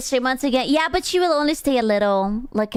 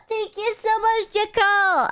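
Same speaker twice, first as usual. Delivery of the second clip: high singsong thanks; 8 semitones higher than usual. Acted.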